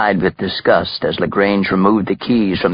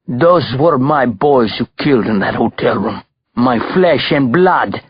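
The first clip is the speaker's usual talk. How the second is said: put-on higher, aggrieved Russian-accented outburst, slowing at the end; anger and despair; 4 semitones higher than usual. A narrator's reading. Belova confronts his sons' killer, anger and despair. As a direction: accuse him, anguished and angry, in a Russian accent. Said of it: "he said with equal parts anger and despair".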